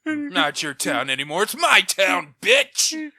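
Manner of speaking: gloating shout. Acted. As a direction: bellow it like you own the place. Player gloats over beaten thug.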